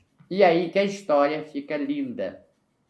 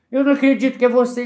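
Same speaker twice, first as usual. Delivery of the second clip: excited shout; 7 semitones higher, 7 dB louder, faster than usual. Retold or imitated. Crowd of fans greeting her.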